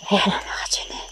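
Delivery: soft unvoiced whisper. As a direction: whisper a warning. Creatures near again.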